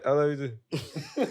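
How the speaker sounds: slow, laughing reply; laughing dad reply. Retold or imitated. The dad answering his son's yelled love, laughing. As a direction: laugh through the dad's answer. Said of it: "He said".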